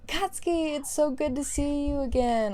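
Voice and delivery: gushing hello that settles into fond scolding; delighted greeting. Acted. Mother greeting her son in his classroom, delighted.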